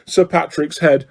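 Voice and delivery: ordinary delivery, nothing marked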